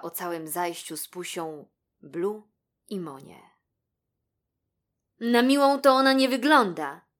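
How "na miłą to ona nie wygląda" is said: loud, raised, decided verdict; firm. A narrator's reading. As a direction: state it firmly as the rabbit. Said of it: "stwierdził stanowczo Blue". (stanowczo i głośno)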